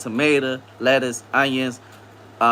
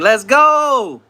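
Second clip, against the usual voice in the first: imitating an excited cheer; 8 semitones higher, 6 dB louder than usual. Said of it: "Anna just saying".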